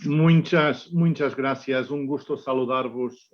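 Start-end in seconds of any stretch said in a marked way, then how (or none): none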